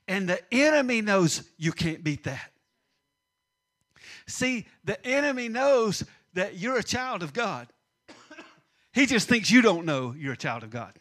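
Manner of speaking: ordinary talk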